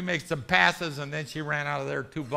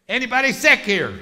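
loud, raised call to a crowd; 6 semitones higher, 7 dB louder than usual. Retold or imitated. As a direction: call it out to the whole room. Calling out to the congregation.

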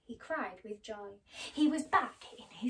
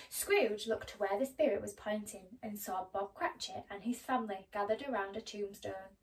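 usual speaking voice

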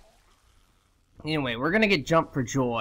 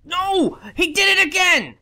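high, loud cry of disbelief; real high-pitched gamer frustration; exasperated yell; 13 semitones higher, 6 dB louder than usual. Real, not acted. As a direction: yell in protest at being beaten. Hit again by same enemy.

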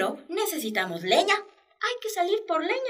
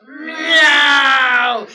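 loud, wailing villain meow; evil cat meow; 2 semitones higher, 12 dB louder than usual. Shown as a demonstration. Teacher gives the second wicked meow, loud and wailing. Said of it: "El segundo maullido".

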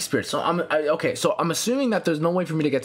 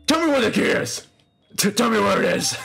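hammed-up high yell; mock interrogation; 6 semitones higher, 4 dB louder than usual. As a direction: playfully demand it at raised pitch.

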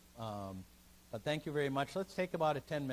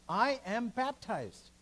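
defiant declaration; 6 semitones higher, 5 dB louder, much slower than usual. Retold or imitated. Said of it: "he turns around and says".

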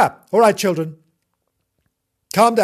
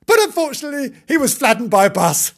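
higher, louder comic announcement; gleeful aside; 9 semitones higher, 5 dB louder than usual. Acted. Storyteller springing bad news on children, gleeful. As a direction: deliver the grim detail with mock cheer.

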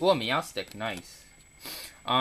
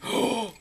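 sharp excited gasp; 10 semitones higher, 5 dB louder than usual. Real, not acted. Last card of the set.

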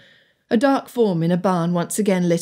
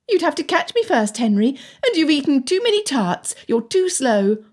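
calling out tauntingly; 7 semitones higher than usual. A narrator's reading. Anne taunting Henry from her hiding place, calling out tauntingly. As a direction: call it down teasingly, pitched high. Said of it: "Anne called".

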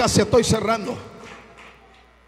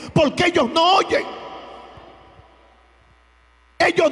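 loud, high-pitched preacher's cry; preaching at full force; 5 semitones higher, 4 dB louder than usual. Acted. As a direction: preach it with full fire to the congregation. Pastor predicando a toda voz. (predicación a todo pulmón)

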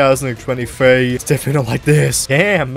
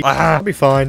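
normal talking voice

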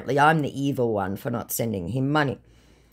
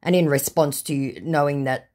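ordinary delivery

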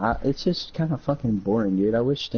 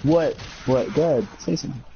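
usual speaking voice

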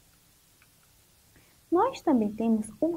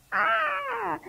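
high, drawn-out "ah" of pretend realisation; mock "aha" of discovery; 12 semitones higher, 5 dB quieter than usual. Teacher playing delight at solving the word, high drawn-out ah. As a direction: let out a long high ah as if it just clicked.

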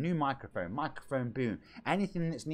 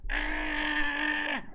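much higher, put-on cartoon baby voice; Stewie impression; 13 semitones higher, much slower than usual. Streamer answers chat doing Stewie, high squeal. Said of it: "didn't Stewie Griffey and Family Guy".

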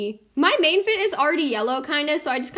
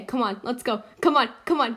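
ordinary talk, nothing marked

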